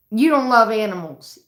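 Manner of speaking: loud taunt. Retold or imitated. Taunting dad again.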